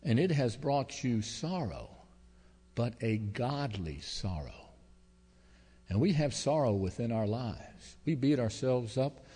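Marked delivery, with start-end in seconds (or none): 0.0-4.7 slow, hushed reading of the apostle's words; reading scripture. Retold or imitated. Pastor reads the apostle's letter to the congregation, slow and hushed. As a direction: let the verse land slowly and gently. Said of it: "I've got the apostle here saying".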